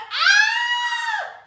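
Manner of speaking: loud high shriek of fright; scared scream. Acted. Storyteller as little girl hearing the door squeak, scared scream.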